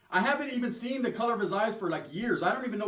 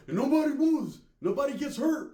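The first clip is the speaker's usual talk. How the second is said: mock tough threat; 7 semitones higher, 4 dB louder than usual. Cousin threatening, higher.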